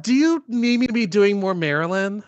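high mocking imitation; mock the flat actress; mocking Karen. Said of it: "mocking Karen's clip line".

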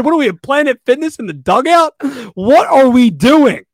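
hoarse, worn-out ranting voice; strained hoarse voice. Real, not acted. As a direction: voice going from yelling. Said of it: "I'm losing my voice".

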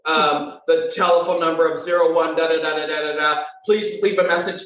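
nonchalant voicemail. Retold or imitated. Mocking a default voicemail, bored and low. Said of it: "it's just nonchalant".

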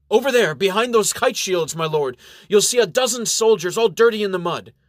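high, loud report to a lord; calling out. A narrator's reading. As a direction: shout the sighting across the battlefield. Soldier calls the sighting to baron.

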